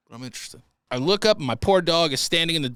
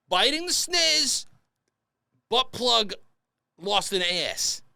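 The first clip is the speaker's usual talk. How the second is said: game show host; 7 semitones higher, slower than usual. Acted. Game-show comparison announcement.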